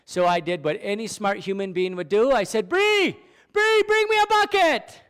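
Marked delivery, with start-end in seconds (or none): 2.7-5.0 high, loud call across the yard. Retold or imitated. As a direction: shout for her to bring a bucket. Calling to his wife outside.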